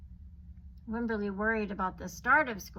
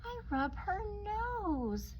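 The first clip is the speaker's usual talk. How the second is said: put-on much higher and slow, a child's voice; high little girl voice; 11 semitones higher, much slower than usual.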